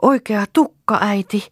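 high, loud cry of discovery; little girl calling out in excitement; child's shout. A narrator's reading. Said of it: "huusi Lea".

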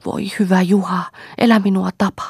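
high pleading cry; a high, frightened wail pleading with her husband. Acted. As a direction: beg him for your life, voice up high.

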